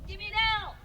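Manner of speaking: calling out. Real, not acted. Cheer call to the crowd.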